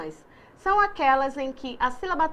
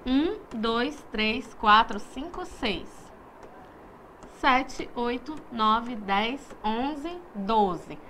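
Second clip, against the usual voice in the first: put-on slow sing-song counting, each number set apart for the kids to join; counting with children; slower than usual. Shown as a demonstration. Teacher counting together with the class, slow and inviting. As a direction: count the numbers out one by one for little ones. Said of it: "vamos contar juntos?".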